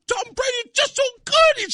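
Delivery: mock a frustrated crying kid; a light imitation of a sobbing little boy. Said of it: "the kid starts crying".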